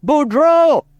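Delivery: high, drawn-out farmer's holler; acted yell. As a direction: call out to a mule like a Cajun farmer. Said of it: "he yells out".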